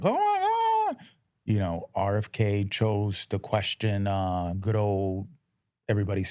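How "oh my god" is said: high, drawn-out mock wail; mock freak-out. Imitating people losing their crap over RFK, mock hysterical wail. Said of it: "people lost their crap".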